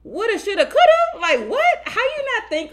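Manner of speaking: high, loud disbelief; scoffing at his woulda shoulda coulda; incredulous. Real, not acted. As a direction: shriek of disbelief at the excuse.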